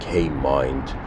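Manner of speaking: normal talking voice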